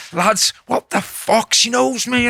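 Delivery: high, incredulous protest; re-enacted exasperated disbelief; retold exasperation. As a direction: protest to your mates, pitch climbing. Retold protest to laughing mates.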